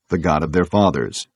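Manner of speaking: plain reading voice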